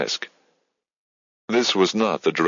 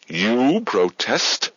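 slow, higher incredulous demand; 5 semitones higher, much slower than usual. A narrator's reading. Director demands, outraged at Midge's protest, slow and higher. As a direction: throw her word back at her. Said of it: "the director demanded".

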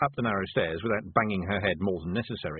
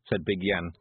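usual speaking voice

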